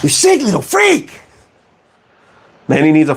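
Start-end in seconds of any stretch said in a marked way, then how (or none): 0.0-1.2 much higher, strained cartoon snarl; Ren impression. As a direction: screech it like Ren. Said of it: "sounds like Ren from Ren and Stimpy".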